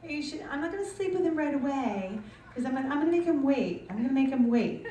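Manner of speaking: high, prim girly mimic; mock the coy girlfriends in a high voice; girlfriends vowing to wait. Comic mimics girlfriends who make guys wait, high and prim. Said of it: "girlfriends who are like".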